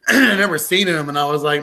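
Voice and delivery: usual speaking voice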